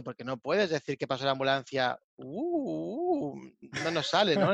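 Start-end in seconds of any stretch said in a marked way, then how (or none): 2.2-3.5 high swooping "uh" like a siren; wailing siren imitation. Shown as a demonstration. Showing why a human cannot do sirens, wailing imitation. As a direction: hoot a rising and falling siren wail. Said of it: "intentando representar ese sonido".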